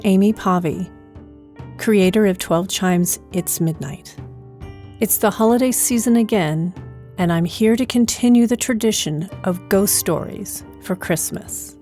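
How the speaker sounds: normal talking voice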